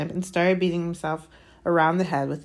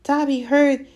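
high, loud elf voice; hoarse house-elf; 10 semitones higher, 8 dB louder, slower than usual. A narrator's reading. Elf telling Harry a rumour, hoarse. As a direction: confide it as a high-pitched, agitated elf. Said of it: "he said hoarsely".

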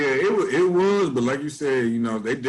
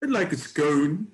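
lightly put-on British accent ordering a scone; a hint of a posh British voice; mock British accent; 2 semitones higher than usual. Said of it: "in the British accent".

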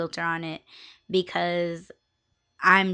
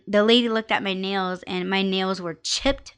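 normal talking voice

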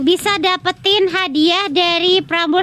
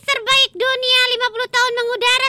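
radio jingle read; 6 semitones higher than usual. Acted. Station slogan read.

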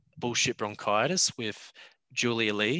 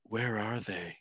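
Dafoe mutter; 7 dB quieter, slower than usual. Retold or imitated. Podcaster quotes Willem Dafoe's trailer line, muttered. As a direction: mutter it like Willem Dafoe, under your breath. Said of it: "Willem Dafoe mutters".

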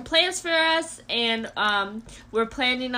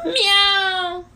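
high, loud whiny meow; 7 semitones higher, 6 dB louder than usual. Retold or imitated. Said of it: "it's like".

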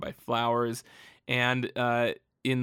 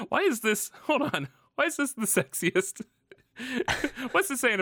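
surprised; 12 semitones higher than usual. Real, not acted. Amused disbelief at sexy collar.

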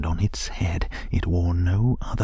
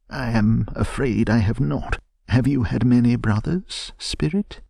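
put-on higher, louder old miser asking; timid Scrooge; 5 semitones higher, 4 dB louder than usual. A narrator's reading. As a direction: ask the spirit nervously. Scrooge answers the ghost.

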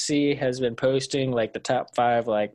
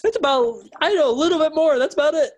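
excited; 12 semitones higher, 3 dB louder than usual. Acted. Mocking the hype voice.

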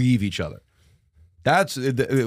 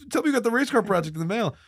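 fast, pitched-up begging; frantic high plea; 11 semitones higher, faster than usual. Retold or imitated. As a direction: beg mom quickly in a raised voice. Frantic call to mom.

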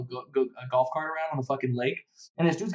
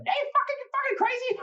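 high-pitched imitation of an angry mom; mock mom yell. Son imitates his mom scolding him, high and shrill-voiced. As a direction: squeal it like a shocked mother.

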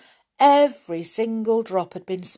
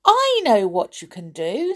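higher, louder burst of inspiration; sudden idea; 4 semitones higher, 5 dB louder than usual. A narrator's reading. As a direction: blurt out a bright idea. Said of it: "said R-, Rick suddenly".

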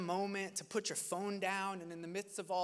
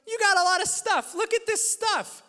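put-on much higher, louder child's voice; son repeating it; 14 semitones higher, 12 dB louder than usual. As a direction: play the little boy pointing at everything. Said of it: "And he goes".

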